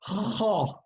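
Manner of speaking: "Kho" raspy from the back of the throat, rounded lips; "Kho" heavy, mouth round like an oval. Shown as a demonstration. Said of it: "actually I'm gonna do it".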